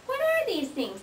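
put-on coaxing a toddler with a high voice; high singsong question. Shown as a demonstration. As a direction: ask it in a high, bright-eyed singsong. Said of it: "Say grapes".

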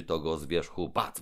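usual speaking voice

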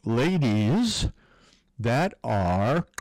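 ordinary talk

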